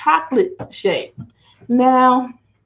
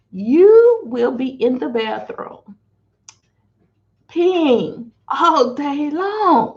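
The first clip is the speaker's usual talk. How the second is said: slow, drawn-out warning; dramatic; 4 semitones higher, slower than usual. Real, not acted. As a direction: stretch it out for comic effect. Comic warning about peeing.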